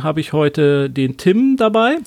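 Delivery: ordinary delivery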